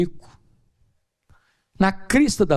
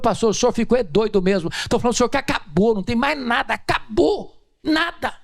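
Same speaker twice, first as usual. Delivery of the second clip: higher and fast, climbing exasperation; exasperated woman; 7 semitones higher than usual. Retold or imitated.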